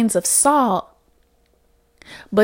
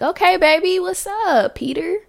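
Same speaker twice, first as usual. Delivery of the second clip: high, sing-song flirting; flirty; 9 semitones higher than usual. Retold or imitated. Replying to the flirty nail tech, high and coy. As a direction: coo at him playfully.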